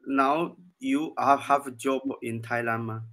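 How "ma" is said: ordinary delivery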